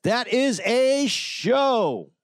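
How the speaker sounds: high, drawn-out sign-off; slow sing-out to close the show. Pretend. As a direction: stretch each word out high, dropping at the end. Podcast sign-off.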